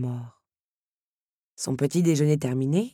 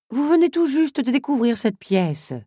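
higher, louder scolding grumble; grumbling; 9 semitones higher, 6 dB louder than usual. Acted. Teapot grumbles at Belle for reading all night. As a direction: grumble like a fussing old teapot. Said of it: "rouspéta la théière".

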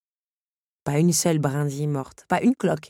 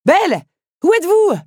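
high, loud searching call; 12 dB louder than usual. Acted. Henri searching for Belle in the orchard, high and loud. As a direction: shout to find her. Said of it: "demanda Henri".

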